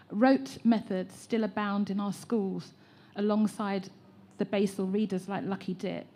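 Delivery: normal talking voice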